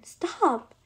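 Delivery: yell. A narrator's reading. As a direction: yell it as a command to halt. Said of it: "the owner yelled".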